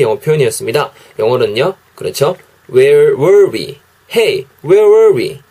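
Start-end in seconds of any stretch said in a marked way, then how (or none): 2.7-3.8 slow, careful Where were we?; teacher modelling "Where were we?" slowly; slow English demo. Shown as a demonstration. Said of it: "이렇게 발음하시면 돼요". 4.1-5.4 higher, drawn-out "Hey, where were we?"; teacher acting out "Hey, where were we?"; lively English demo. Shown as a demonstration. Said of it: "이렇게 발음하시면 돼요".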